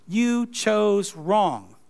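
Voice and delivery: You chose wrong spelled out slowly, each word weighted; slow emphatic accusation. Shown as a demonstration. Moses telling God he picked the wrong man, slow and weighty. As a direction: lay it down word by word. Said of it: "He didn't say that, but that's what he's saying".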